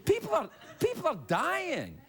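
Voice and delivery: high-pitched, earnest appeal; impassioned protest. Real, not acted. Host telling a laughing audience people are dying, impassioned protest. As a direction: press the point in real dismay. Pleading over laughter about real deaths.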